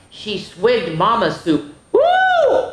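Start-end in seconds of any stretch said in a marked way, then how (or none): 1.9-2.7 very high, loud woo; startled whoop. Acted. Girl tasting Mama's cold soup, startled whoop. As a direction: yelp at a shock of cold.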